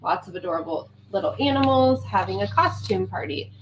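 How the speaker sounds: ordinary talk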